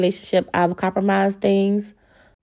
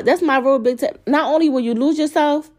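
normal talking voice

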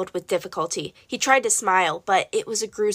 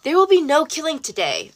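a higher, commanding king's voice; cold king; 7 semitones higher than usual. Acted. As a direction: cut in with a ruler's authority. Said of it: "a voice said".

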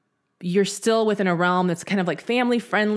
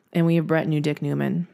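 normal talking voice

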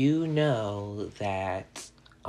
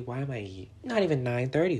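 normal talking voice

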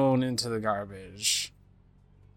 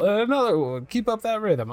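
put-on much higher, loud drunken demand; 10 semitones higher, 4 dB louder than usual. Drunk man demanding another drink, high and loud. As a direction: bellow it like a happy drunk. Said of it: "he's very drunk now".

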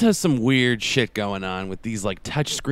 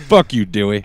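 louder acted quote; 6 dB louder than usual. Acted movie quote exchange.

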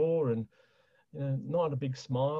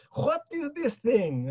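much higher, slow baffled Greek father voice; Greek accent dad; 13 semitones higher, slower than usual. Retold or imitated. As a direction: drag it out like the puzzled Greek dad. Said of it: "and his great accent".

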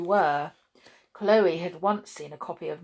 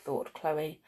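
ordinary delivery, nothing marked

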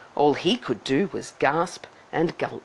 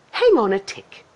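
fairy's reply; 5 semitones higher, 4 dB louder than usual. Acted. Fairy replies to Cindy.

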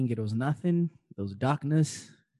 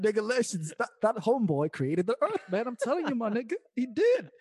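high-pitched, laughing protest; comic insistence; 10 semitones higher than usual. Real, not acted. Joking insistence amid shared laughter.

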